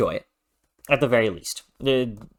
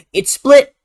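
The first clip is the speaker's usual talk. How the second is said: excited; 11 semitones higher, 11 dB louder than usual. Real, not acted. Excited announcement of split choice.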